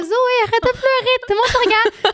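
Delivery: high-pitched mock scolding mother; high imitation of an embarrassed mom; imitating mother. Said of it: "T'as ma mère qui arrive".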